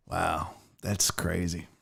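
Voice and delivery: ordinary talk, nothing marked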